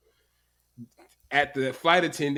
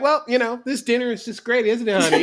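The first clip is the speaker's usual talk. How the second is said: higher, louder breezy parent voice; oblivious cheery parent; 5 semitones higher, 4 dB louder than usual. Retold or imitated. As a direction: act like nothing is wrong.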